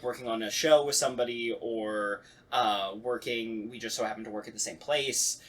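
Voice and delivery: usual speaking voice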